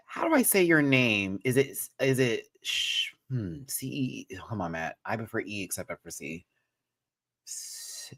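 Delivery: ordinary talk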